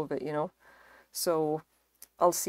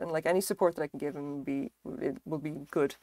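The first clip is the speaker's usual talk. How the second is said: ordinary delivery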